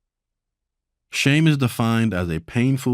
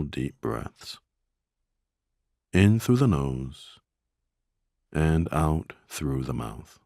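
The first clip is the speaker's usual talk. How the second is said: guided meditation; 4 semitones lower than usual. Acted. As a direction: ease them into stillness, a little lower and unhurried.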